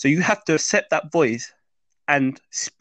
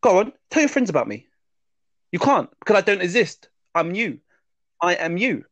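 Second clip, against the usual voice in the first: a higher, mocking voice of his fears; taunting inner voice; 5 semitones higher than usual. Subconscious goading the man in the lift, higher and mocking. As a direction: tease him as the voice in his head. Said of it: "the guy goes".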